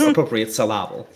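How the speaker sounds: "syllable" as sil-AH-ble, jokily; misplaced stress. Shown as a demonstration. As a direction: punch the middle of "syllable". Echoes the misplaced-stress joke.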